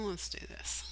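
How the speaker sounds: whisper. Real, not acted. Aside whisper while setting up.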